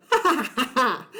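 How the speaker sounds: taunting ha ha ha; mocking laugh. Mean girl laughs at scared new boy, mocking. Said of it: "Meredith Mooney laughed".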